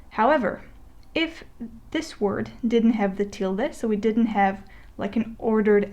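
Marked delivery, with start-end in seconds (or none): none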